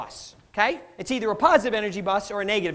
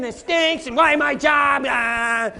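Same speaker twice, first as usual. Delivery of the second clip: loud mock griping; hammed-up whine about the job; whining complaint; 3 semitones higher, 6 dB louder, slower than usual. Coworker griping about his job and the boss, loud mock whine. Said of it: "whining and complaining".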